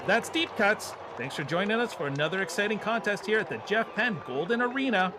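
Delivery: high, excited play-by-play wrap-up; baseball announcer voice. Acted. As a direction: call the game like a radio announcer. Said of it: "baseball announcer voice".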